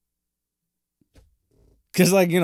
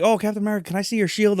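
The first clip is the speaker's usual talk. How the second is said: put-on kid voice; 9 semitones higher, faster than usual. Kid voiced begging for shield.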